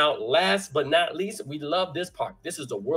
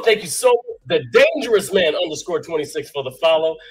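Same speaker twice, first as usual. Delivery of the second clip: ordinary delivery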